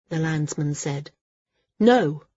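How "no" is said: raised, firm "No"; a contained but emphatic no; firm refusal. A narrator's reading. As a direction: refuse in one short word. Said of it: "said Maris".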